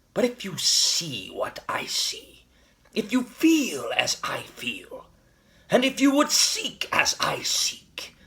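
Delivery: drawn-out, breath-heavy dramatic intensity; intense rallying appeal. Acted. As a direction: stretch each parallel phrase, pressing harder every time. Rallying climax of the speech.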